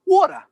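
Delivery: put-on water in a Brooklyn accent, pitched high and loud; wife's Brooklyn accent. Quoting his wife from Brooklyn saying "water", Brooklyn accent. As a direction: punch out "water" with a dropped R, Brooklyn-style. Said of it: "when she said water".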